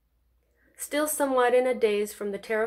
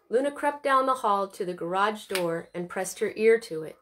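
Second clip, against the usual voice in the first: plain reading voice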